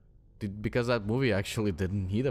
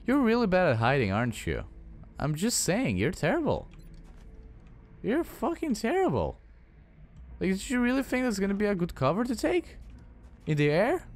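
taunting; 11 semitones higher than usual. Real, not acted. Taunting a hidden enemy.

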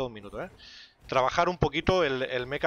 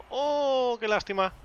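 disappointed cry; 9 semitones higher, slower than usual. Real, not acted. Near miss in the game.

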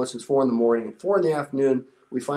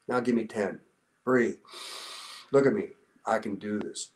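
soft coaching; 5 semitones lower, 6 dB quieter than usual. Retold or imitated. Coaching exhausted recruit through burpees.

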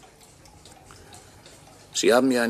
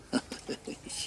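whispered bitter laugh; 10 dB quieter than usual. Acted. Ghost laughs at the scheme.

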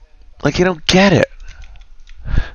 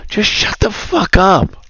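exasperated outburst; 10 semitones higher than usual. Real, not acted. Ranting about girls nagging.

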